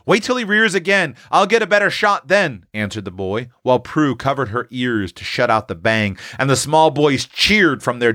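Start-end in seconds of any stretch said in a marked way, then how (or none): 0.0-2.7 put-on higher-pitched boy holding his nerve; urgent young brother's reply; tense boy hunter. A narrator's reading. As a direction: insist on waiting, keyed up. Eph holds fire as bear nears.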